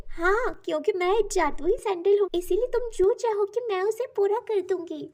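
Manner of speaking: high storybook magic-creature voice; magical sandal's voice. A narrator's reading. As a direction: introduce yourself as a magical talking object. Magical sandal figure speaking.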